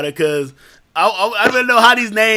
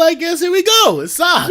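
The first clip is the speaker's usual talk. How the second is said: higher, louder mock proclamation; shouting the big reveal to the crowd; resigned announcement; 11 semitones higher, 4 dB louder than usual. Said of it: "I was like".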